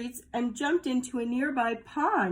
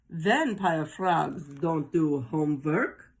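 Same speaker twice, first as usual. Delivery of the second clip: slow vampire voice; 4 semitones lower, slower than usual. Acted. Froggy in vampire role.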